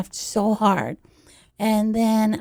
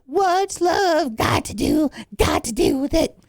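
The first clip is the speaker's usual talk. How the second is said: slow, raised, half-sung line; 5 semitones higher than usual. Retold or imitated.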